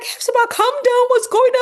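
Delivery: loud, high, urgent attempt to calm; alarmed caller. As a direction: cry out to calm a panicking friend. Alarmed caller answers the panic.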